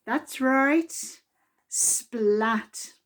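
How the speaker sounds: plain reading voice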